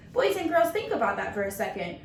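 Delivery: usual speaking voice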